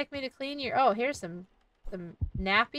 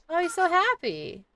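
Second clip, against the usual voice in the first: laughing delight; 5 semitones higher, 5 dB louder than usual. Real, not acted. Delighted at fed baby.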